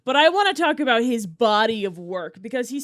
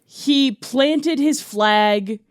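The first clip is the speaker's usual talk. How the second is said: slow grand pronouncement; 3 semitones higher, 3 dB louder, much slower than usual. Acted. Dramatic pronouncement.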